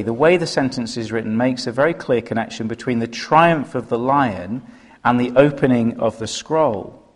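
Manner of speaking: ordinary talk, nothing marked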